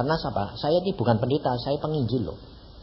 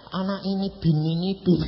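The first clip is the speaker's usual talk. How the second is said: ordinary delivery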